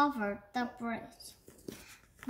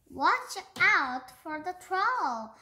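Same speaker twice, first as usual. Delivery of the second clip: loud, high warning shout; 6 semitones higher, 6 dB louder than usual. Acted. Buffy warning Beaver about the troll, loud shout. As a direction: yell it after him as he runs off. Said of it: "Buffy shouted after him".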